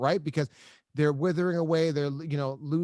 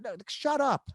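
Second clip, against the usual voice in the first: higher-pitched, incredulous refusal; retold disbelief; 7 semitones higher than usual. Son brushing off his sister's terrible news, disbelieving.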